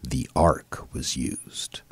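slow, hushed, ominous whisper; dark whisper. Retold or imitated. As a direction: breathe it like a secret. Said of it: "whispered the monk darkly".

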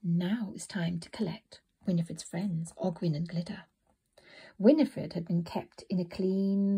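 ordinary delivery

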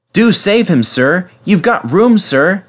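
high, earnest appeal; eager entreaty to a superior; pleading. A narrator's reading. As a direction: beg respectfully on a friend's behalf. Edward pleads with the vicar.

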